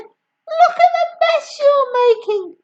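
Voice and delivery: high, loud complaint; boy complaining about the mess; upset protest. Acted. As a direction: scold her in dismay. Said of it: "George said".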